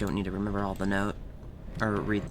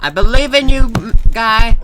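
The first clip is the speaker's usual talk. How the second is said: much higher and louder climax of cheering; frantic peak of encouragement; excited scream; 11 semitones higher, 21 dB louder than usual. Real, not acted. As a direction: scream it at the top of your voice. Cheering repeated, peaking.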